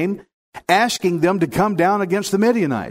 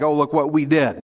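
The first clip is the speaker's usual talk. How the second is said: mock brag. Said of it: "he didn't want Israel to brag".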